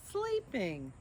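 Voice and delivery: ordinary delivery, nothing marked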